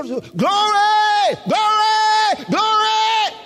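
high, loud cries of praise; shouting glory. Shown as a demonstration. Preacher contrasts outbursts with everyday praise, shouting. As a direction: shout each one out high. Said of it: "why are they shouting?".

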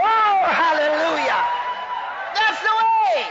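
real high, loud shout of praise; whooping preacher's hallelujah; exultant shout. Real, not acted. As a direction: cry out in triumph. Preacher exulting mid-sermon.

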